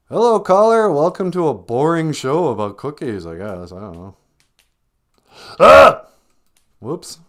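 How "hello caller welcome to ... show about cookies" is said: higher, louder phone-in host greeting; host picking up the call; cheesy radio host. Acted. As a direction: greet the caller like a bored talk-show host.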